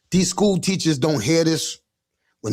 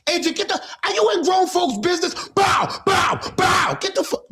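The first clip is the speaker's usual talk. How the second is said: parent yelling; 11 semitones higher than usual. Retold or imitated. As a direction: yell like mom catching the kid listening in. Parents yelling at nosy kid.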